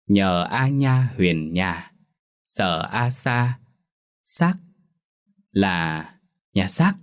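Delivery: slow, syllable by syllable spelling out; sounding out words. Acted. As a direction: spell out each syllable haltingly, then read the whole word. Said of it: "lẩm nhẩm đánh vần từng chữ".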